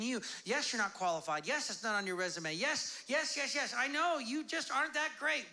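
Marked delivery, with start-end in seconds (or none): none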